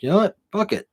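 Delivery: high-pitched, throw caution to the wind; retold snap decision, pitched well up; impulsive resolve. Storyteller recalls deciding to grab the briefcase, impulsive resolve.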